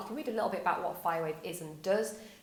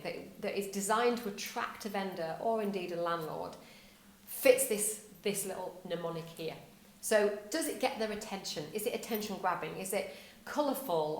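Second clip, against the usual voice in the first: normal talking voice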